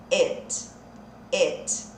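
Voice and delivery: it pronounced like et, an e vowel, not i; it said with e sound. Shown as a demonstration. Teacher shows students it sounds like et. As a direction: say it as et, short e vowel. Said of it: "ele tem um som de e".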